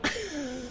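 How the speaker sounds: quick, soft chuckle. Real, not acted. Streamer amused by Ranger, soft chuckle. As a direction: chuckle to yourself, softly.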